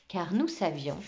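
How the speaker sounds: ordinary delivery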